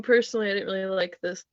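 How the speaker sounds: ordinary talk, nothing marked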